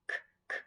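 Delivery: soft clipped k- repeated; hint the first sound of cow. Shown as a demonstration. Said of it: "say that first sound".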